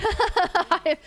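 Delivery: high, loud laugh. Real, not acted. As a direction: laugh at yourself. Laughs at own tears.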